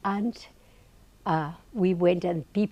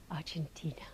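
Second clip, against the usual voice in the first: whisper; 3 semitones lower, 14 dB quieter than usual. Retold or imitated. Timid answer to the guru.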